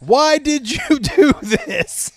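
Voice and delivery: high, stretched-out yell breaking into laughter; mock yelling. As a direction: wail it in all caps, cracking up. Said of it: "all caps".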